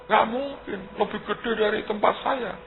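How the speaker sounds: an amazed mayor's man, voice pitched high; mock astonishment. Imitating astonished official.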